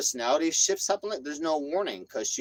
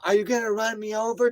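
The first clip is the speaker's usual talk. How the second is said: high, louder mock holler; retold yelling; 9 semitones higher, 5 dB louder than usual. Woman circling on her bike at man, mock yelling. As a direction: imitate her yelling, pitched way up. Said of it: "Yelling though".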